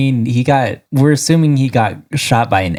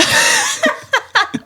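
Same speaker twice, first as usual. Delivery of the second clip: high squealing laugh. Real, not acted. After catchphrase.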